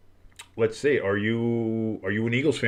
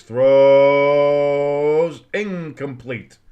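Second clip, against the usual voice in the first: excited call; 3 semitones higher, 9 dB louder, much slower than usual. Real, not acted. Live play-by-play of a pass.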